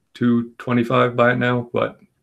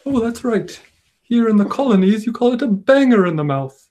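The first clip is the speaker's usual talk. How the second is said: much higher, put-on mock British voice; Tobias Funke; 12 semitones higher, 3 dB louder than usual. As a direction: camp faux-English showman. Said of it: "Tobias Funke impression".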